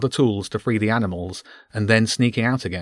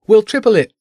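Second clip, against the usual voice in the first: louder pleading offer; 11 semitones higher, 8 dB louder than usual. A narrator's reading. Jenny shouts higher bribe.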